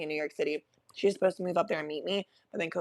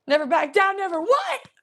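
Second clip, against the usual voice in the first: battle cry; 12 semitones higher, 4 dB louder than usual. Pretend.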